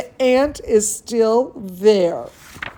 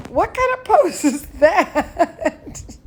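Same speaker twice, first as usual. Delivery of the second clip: high, giggling question; laughing; 8 semitones higher, slower than usual. Real, not acted. Teacher laughing at her dog Chester's pose, high giggle. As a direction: laugh at the dog's silly pose.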